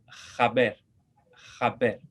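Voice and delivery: "jaber" with a throaty j like Spanish jota, then silent-free r; Ladino jaber. Shown as a demonstration. Teacher modeling Ladino for class, "jaber" with a jota sound. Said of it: "se pronuncia diferente".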